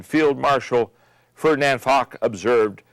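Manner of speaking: normal talking voice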